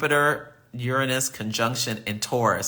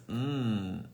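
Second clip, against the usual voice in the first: normal talking voice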